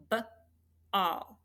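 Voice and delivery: ball split into b and all; tapped-out sounds. Shown as a demonstration. Teacher tapping out ball for students, sound by sound. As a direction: tap out b, then all, separately. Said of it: "if we tap it out".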